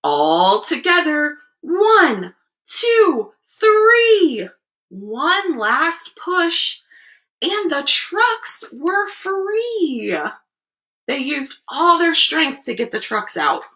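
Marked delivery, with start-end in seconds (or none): 0.0-10.2 high, drawn-out build to the big finish; dramatic storybook countdown. A narrator's reading. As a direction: count up with rising pitch, then stretch it out. Reads picture book to children.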